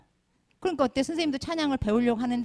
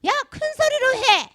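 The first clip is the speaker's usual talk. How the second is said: very loud, high-pitched mock bellow; full-volume shouted order, acted out; imitated yelling; 10 dB louder than usual. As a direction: imitate an elder yelling at a choir. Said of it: "어떻게 소리를 질렀냐면".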